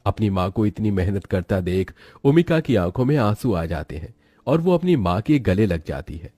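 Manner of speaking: ordinary delivery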